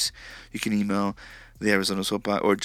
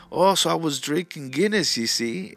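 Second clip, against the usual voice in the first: put-on higher, louder, botched Irish accent; bad Irish accent; 6 semitones higher, 4 dB louder than usual. Host mimicking an Irish drinker, in a bad Irish accent. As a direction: attempt an Irish accent and miss. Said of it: "I can't do an Irish accent".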